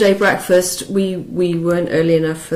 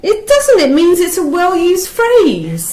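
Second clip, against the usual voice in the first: much higher and louder protest; indignant; 10 semitones higher, 5 dB louder than usual. Real, not acted. Defending Urban Dictionary to her friend, high indignant protest. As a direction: insist hotly, voice jumping up.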